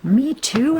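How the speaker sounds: put-on high small child voice; lightly voiced little girl asking; little Nell. A narrator's reading. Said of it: "asked little Nell".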